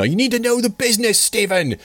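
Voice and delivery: high, fast British phone rant; mock British yelling. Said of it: "this British dude on the phone, and he starts yelling at me".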